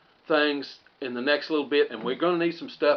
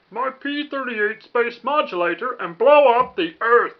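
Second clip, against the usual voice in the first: high, loud cartoon alien voice; squeaky little Martian villain announcing his plan; Marvin the Martian; 7 semitones higher, 6 dB louder than usual. Pretending to be Marvin the Martian for the radio play. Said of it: "I can do Marvin the Martian".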